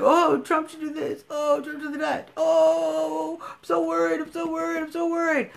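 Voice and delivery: hammed-up worried whine; mocking whiny worrier. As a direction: sneer at the fretting fans with a whiny voice. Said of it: "you're gonna post things like".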